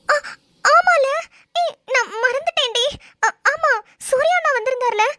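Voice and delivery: high-pitched jolt of remembering; sudden realisation. Acted. Friend suddenly remembering who came, startled realisation. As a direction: jump in pitch as it dawns on you.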